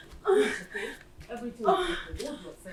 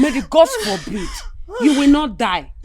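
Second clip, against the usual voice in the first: loud firm reassurance; 5 semitones higher, 8 dB louder than usual. Acted. Mother answering cries of death.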